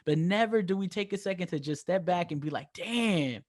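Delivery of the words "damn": usual speaking voice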